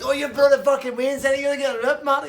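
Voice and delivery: high, loud raging yell; furious shout. Retold or imitated. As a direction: scream it across the bar. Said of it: "Tasty Dave's shouting at it".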